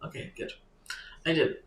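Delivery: normal talking voice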